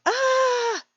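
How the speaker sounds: high mock yelp of fright, then frozen; startled gasp. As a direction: imitate a sudden startled cry. Startled person freezing.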